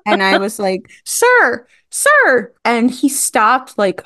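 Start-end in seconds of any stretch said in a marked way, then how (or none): 1.0-2.5 very high-pitched insistent hail, retold; mock calling out. Fan following a star down the street, imitated calling out. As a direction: call after someone in a high, hammed-up voice.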